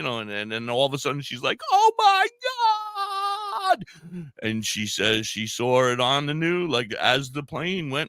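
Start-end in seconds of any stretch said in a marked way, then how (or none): 1.7-3.8 very high, loud wail of horror; retold scream. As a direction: imitate a screaming wife, stretching the last word. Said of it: "she was just screaming".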